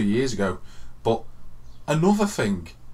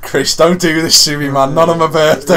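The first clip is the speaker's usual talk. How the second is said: higher, louder, begging in despair; loud desperate plea; 5 semitones higher, 10 dB louder than usual. Retold or imitated. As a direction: beg like you're tearing your hair out. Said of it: "pulling my hair out, going".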